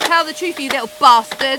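shouting in a rage; angry yell. Acted. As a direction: bark it at him furiously. Cleaner attacking suspect with hoover.

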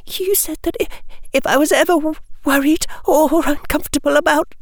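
put-on high, halting girl's voice; wavering. A narrator's reading. Tearful pupil reminding professor of her offer, wavering and halting. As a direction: waver and break up the words, pitched very high. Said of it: "said the young girl in a wavering voice".

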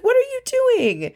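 very high, drawn-out mock astonishment; stretched-out squeal of a question, acted; delighted surprise. Acts out Jill surprised by gift.

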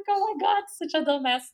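high, exasperated insult; mock-exasperated high retort; friend's high exasperated put-down. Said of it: "She's like".

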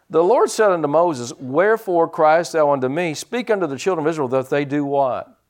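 scripture reading. Real, not acted. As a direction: read the verses with pulpit force.

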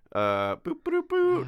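playful boops. Pretend. Sound effects while moving files.

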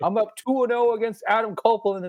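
lightly voiced wrestler's brag. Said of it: "and he's like".

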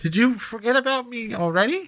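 high, muffled call from behind rocks; muffled yelling voice. Acted. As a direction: call out from behind a rock wall. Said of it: "a muffled voice yelling from behind the rubble".

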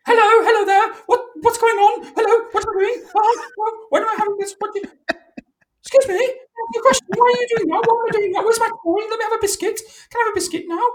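very high squeaky dog voice, frantic questions; hyper Cocker Spaniel. Acted. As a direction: pelt out excitable questions in a high falsetto. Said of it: "So she's kind of like".

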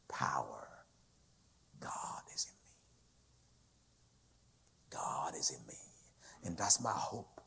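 very soft, near-whispered declaration; hushed reverence. Real, not acted. Preacher softly declaring God is within him, hushed reverence. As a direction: let each phrase sink in, barely voiced.

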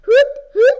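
loud high hoot hoot; ghost whistle. Pretend. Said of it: "They do the ghost whistle".

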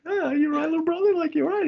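big brother fussing over little brother. Retold or imitated. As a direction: coddle the little brother teasingly. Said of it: "a big brother going to a little brother".

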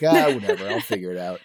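imitating yourself shrugging it off, pitch dropping away; casual self-quote. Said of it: "I'm like".

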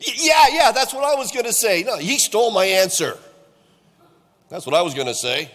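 high, hurried me-too chiming in; squeaky disciples scrambling to claim the answer; mock eager disciples. Disciples chiming in after Peter, mock eager and high. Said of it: "all the others chimed in and said".

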